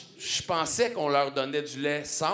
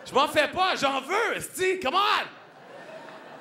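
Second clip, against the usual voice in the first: mock eagerness; 8 semitones higher, slower than usual. Comedian joking he wants the ketamine too, hammed-up begging. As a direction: beg for the drug like a junkie.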